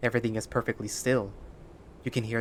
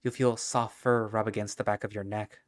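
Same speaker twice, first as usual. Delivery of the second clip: plain reading voice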